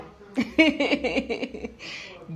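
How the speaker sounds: a real, rising burst of laughter; bright amused chuckle. Real, not acted. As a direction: laugh out loud at the thought of pepper. Laughing at the word Pepe.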